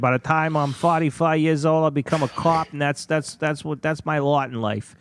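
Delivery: put-on Boston Marine voice; hammed-up Boston accent, rambling on. Imitating a Marine explaining his lot in life, Boston accent. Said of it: "from Boston".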